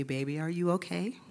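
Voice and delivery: soft, caring question; gentle concern. Retold or imitated. Great-grandmother checking on grieving child, gentle concern. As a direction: ask it softly, as a gentle check-in.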